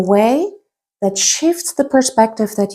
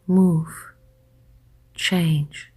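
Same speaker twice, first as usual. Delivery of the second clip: soft, lower voice with long pauses between phrases; guided hypnosis; 3 semitones lower, 7 dB quieter than usual. Acted. Hypnotist guiding listeners to let their thoughts drift, soft hypnotic voice. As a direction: lull the listener gently, hushed and unhurried.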